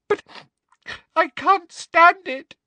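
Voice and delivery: tearful, halting breakdown; sobbing. A narrator's reading. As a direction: wail it brokenly, feigning despair. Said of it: "Hermione was sobbing desperately".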